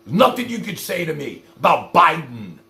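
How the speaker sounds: ordinary talk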